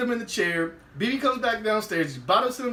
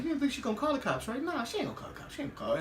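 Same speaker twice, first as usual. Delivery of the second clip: much softer, quick anxious murmur; hushed nervous talk; 4 semitones higher, 11 dB quieter, much faster than usual. Retold or imitated. Friends fleeing in the car, hushed and nervous.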